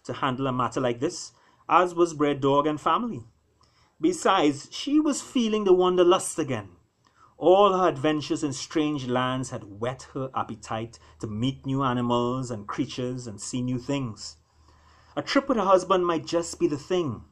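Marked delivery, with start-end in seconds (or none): none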